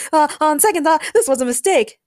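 lightly hammed-up fright; alarmed shout. A narrator's reading. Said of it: "Daniel shouted when he saw Louis' fangs".

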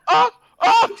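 hammed-up repeated moans; two silly exaggerated moans; mock moans. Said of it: "je vais faire". (gémissements exagérés)

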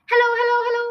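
high, loud squawky call; loud, clear parrot. Acted. Hidden parrot heard across the hall, loud and high. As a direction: sing out from another room in parrot voice. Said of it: "a voice came loud and clear".

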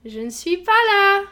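loud, high and slow; shouted message. Retold or imitated. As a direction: yell it out slowly. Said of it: "mon ami qui disait".

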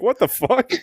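very high mock disbelief; high-pitched comic shock; incredulous reaction. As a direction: squeal it in baffled disbelief. Mock reaction to creepy line.

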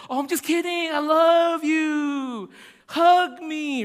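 loud, high, drawn-out gushing; exaggerated baby talk to win back a toddler's attention; talking to a child. Shown as a demonstration. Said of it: "drag on your notes when you talk to a child".

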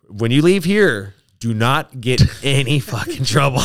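loud, emphatic mock order; retold stern warning. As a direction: lay down the law, imitating a staffer. Staffer's stern warning, acted out.

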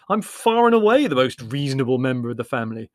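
loud, affronted self-defence; indignant. Acted. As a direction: protest indignantly. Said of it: "said Larry indignantly".

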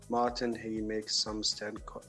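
normal talking voice

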